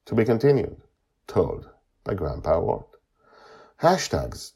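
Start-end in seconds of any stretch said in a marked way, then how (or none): none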